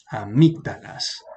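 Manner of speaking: "amígdalas" slowly, stressed on the second syllable, a-MÍG-da-las; stress on MIG. Shown as a demonstration. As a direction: stretch it out, punching MIG in "amígdalas". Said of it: "el acento va aquí". («amígdalas» lento, marcando MIG: aMÍGdalas)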